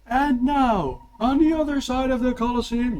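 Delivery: high, loud announcer voice; arena announcer. Acted. Game master announcing the Colosseum fight, loud announcer. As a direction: boom it out to the whole Colosseum. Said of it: "this announcement, by the way".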